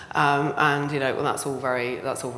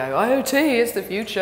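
hyped tech evangelist; 9 semitones higher, 7 dB louder than usual. Retold or imitated. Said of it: "I'm not one of those people who's gonna come and go".

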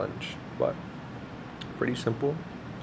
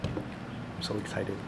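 whispering; 14 dB quieter, much slower than usual. Real, not acted. Whispered while eating dinner.

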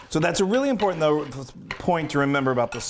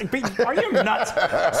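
slow, high, laughing pun; 7 semitones higher, much slower than usual. Real, not acted. Joking pun on the nuts.

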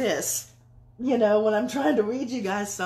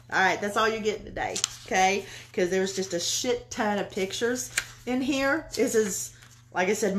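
ordinary talk, nothing marked

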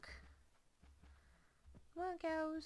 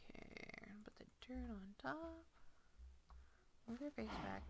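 soft whisper, thinking aloud; whispered to self; 10 dB quieter than usual. Real, not acted. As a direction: murmur the steps under your breath. Whispered to herself while building.

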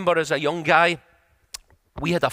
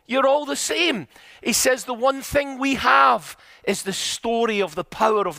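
raised pitch, climbing as the list builds; impassioned preaching; 8 semitones higher, 3 dB louder than usual. Retold or imitated. Friend warns against attacking with scandals, in impassioned preaching voice. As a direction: preach it with mounting intensity. Preaches retold advice at force.

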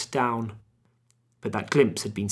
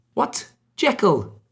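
higher, louder exclamation of a name; surprised cry; 11 semitones higher, 6 dB louder, much slower than usual. A narrator's reading. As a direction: call out on suddenly seeing a friend. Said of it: "he cried".

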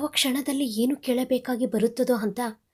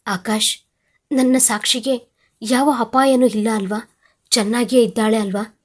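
ordinary delivery

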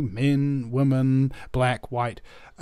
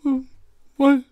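high, hammed-up weepy thankfulness; comic blubbering, overwhelmed by kindness; tearful gratitude; 12 semitones higher, 6 dB louder than usual. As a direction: choke it out through happy sobs. Crying cell overcome with gratitude.

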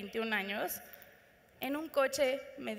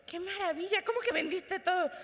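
higher, thrilled amazement; amazed friend; 6 semitones higher than usual. Acted. Friend hears she sold everything, thrilled and high. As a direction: sound delighted and stunned.

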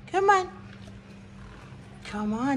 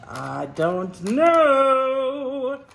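dithering; 5 semitones higher, much slower than usual. Acted. Dithering over packages.

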